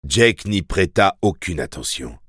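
ordinary delivery, nothing marked

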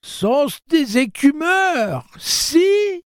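put-on very high, loud old-woman screech; slow, piercing, broken-voiced wail; cracked, quavering cry. A narrator's reading. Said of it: "s'écria-t-elle d'une voix fêlée, chevrotante".

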